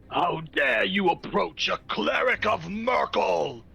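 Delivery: slow, high booming proclamation. Acted. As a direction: thunder it down on the intruders. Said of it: "in a booming voice".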